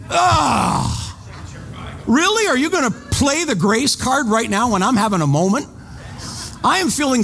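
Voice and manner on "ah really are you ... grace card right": exasperated disbelief. Retold or imitated. Exasperated at the grace remark.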